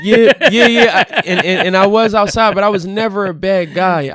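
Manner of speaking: real laugh carried into loud, animated talk; laughing admission. Real, not acted. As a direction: own up through laughter, then defend yourself. Laughing admission when teased about past.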